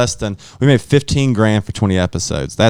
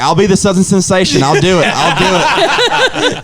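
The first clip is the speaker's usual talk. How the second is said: high, laughing plea to be hired back; squeaky, laughing I'll do it; mock desperate begging; 8 semitones higher, 3 dB louder than usual. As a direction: beg like a washed-up guy pleading for the gig. Mocking his own eager begging.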